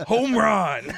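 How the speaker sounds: announcer shout. Retold or imitated. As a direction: belt it out like the stadium mic. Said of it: "for the home run call says".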